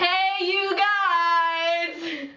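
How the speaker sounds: long, high mock call; drawn-out holler. Addressing a group of people, hammed-up drawn-out holler. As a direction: holler it out, stretching every word.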